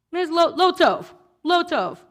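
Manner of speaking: declared, not scolding; emphatic Hebrew. Acted. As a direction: hit "lo tov" hard as a verdict. Quoting Yitro's Hebrew emphatically.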